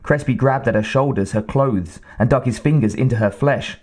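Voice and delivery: ordinary delivery, nothing marked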